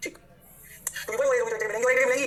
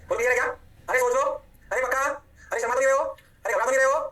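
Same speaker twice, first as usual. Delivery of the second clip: higher, louder prodding questions; calling out checks; 5 semitones higher, 4 dB louder, much faster than usual. Real, not acted. Teacher asks students if they are sure, calling out. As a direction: prod the room with short rising questions.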